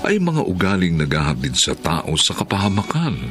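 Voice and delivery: normal talking voice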